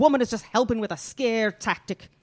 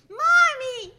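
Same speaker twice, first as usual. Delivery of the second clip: put-on high, loud child's cry for mommy; excited child calling; 6 dB louder than usual. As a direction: call out to mother in a high little voice. Child calls out to mother.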